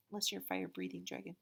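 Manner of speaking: plain reading voice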